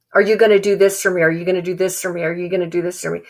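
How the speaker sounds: fast, pushy repeated question; quick-fire demanding repetition; rapid demand. Acted. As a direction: rattle it off like a nagging demand.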